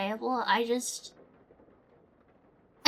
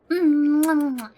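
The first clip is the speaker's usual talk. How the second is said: kiss sounds; 5 dB louder, slower than usual. Real, not acted. Blowing kisses goodbye.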